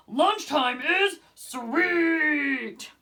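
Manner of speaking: slow, drawn-out happy yell; a gleeful shark yell. A narrator's reading. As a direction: yell it out, delighted. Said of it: "yelled Clark the Shark".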